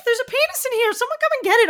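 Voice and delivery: high, loud, fast mock shriek of a frightened woman; hammed-up high squeal of panic; panicked women. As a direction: shriek it like a woman who's seen a mouse. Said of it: "people are jumping on chairs and going".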